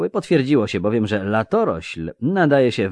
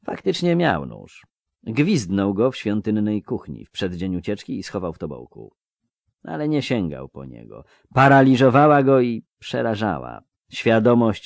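ordinary delivery

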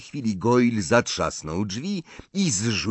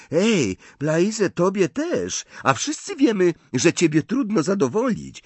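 put-on high, louder girl's voice, needling; Pansy; 11 semitones higher, 4 dB louder than usual. A narrator's reading. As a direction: pipe up high to tease a friend. Pansy teasing Blaise in story.

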